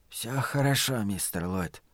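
very soft, weakened murmur; whispering. A narrator's reading. Wounded man forgiving the one who shot him, whispering. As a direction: barely get the words out. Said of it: "прошептал он".

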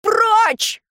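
high, strained scream; anguished yell. A narrator's reading. Someone yells as the arrow flies, anguished yell. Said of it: "Надрывный вопль".

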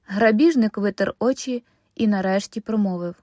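ordinary delivery

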